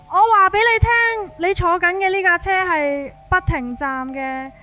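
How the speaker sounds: plain reading voice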